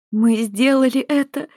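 high, beaming cry of relief; joyful exclaim. Acted. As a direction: burst out with joy. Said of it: "воскликнула Наоми". (радостное восклицание)

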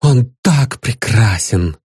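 usual speaking voice

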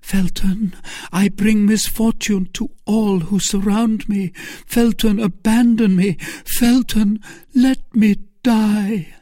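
high, loud anguished lady; desperate pleading. A narrator's reading. Milady begs Felton to abandon her, high and desperate.

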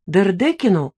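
ordinary talk

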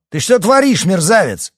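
high-pitched furious yelling; outraged, full-voice shout; enraged shout. A narrator's reading. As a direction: yell at the offender in a rage. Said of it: "разъярённо крикнул Эдуард".

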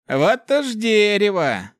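high, loud, long-drawn exclamation; drawn-out high drawl. A narrator's reading. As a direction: stretch the words out high and loud. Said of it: "протянул Хакон".

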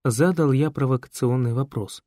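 ordinary delivery

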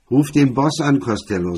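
loud, blaring order over the shoulder. A narrator's reading. Drunk MacDonald blares at Costello, loud and blaring. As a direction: bellow it at him. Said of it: "sagte er mit schmetternder Stimme".

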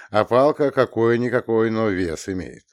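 plain reading voice

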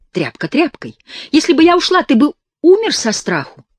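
high, loud contemptuous shout; berating. A narrator's reading. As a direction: scorn his cowardice. Girl berates him. (громко и презрительно)

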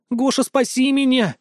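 high-pitched, pleading cry for rescue; tearful plea. A narrator's reading. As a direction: beg desperately through tears. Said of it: "По щекам Люды катились слёзы".

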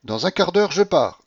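flirtatious call after a departing man; calling after him. Acted. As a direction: shout after him teasingly. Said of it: "me cria-t-elle".

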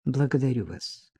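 hushed and low; rustling murmur. A narrator's reading. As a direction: murmur it discreetly like a waiter. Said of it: "прошелестел официант".

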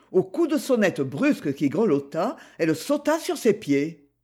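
plain reading voice

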